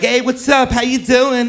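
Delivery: a hint of a put-on flamboyant hello.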